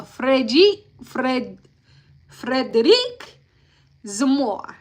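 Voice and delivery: Frederic Zamora in a slow, careful French accent. Shown as a demonstration. Recapping the trial for viewers, saying the witness's name in a French accent. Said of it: "I'm trying my French accent".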